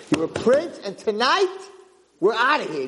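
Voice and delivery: high, emphatic declaration; acted urgency; urgent insistence. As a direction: urge him to flee, pitched high. Old man insists on escape.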